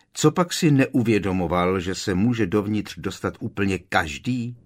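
plain reading voice

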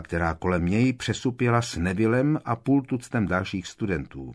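usual speaking voice

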